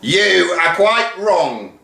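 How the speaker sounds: slow, high, loud correction; deputy head pronouncing; haughty announcement. Acted. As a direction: declare it grandly. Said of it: "announced the deputy".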